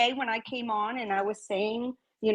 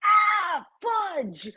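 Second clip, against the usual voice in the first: 3 wail it as a comic slip; 1 mock dismay; 8 semitones higher than usual. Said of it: "I'm like".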